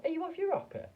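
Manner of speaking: very high, loud character voice; hammed-up high astonished voice; high squeaky voice. A narrator's reading. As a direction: squeak it out in alarm. Wonka and Mike, high voices.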